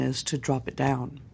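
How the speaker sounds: ordinary talk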